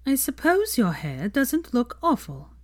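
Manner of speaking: a lightly voiced haughty woman; grudging Pansy. A narrator's reading. Pansy grudgingly compliments Hermione, haughty.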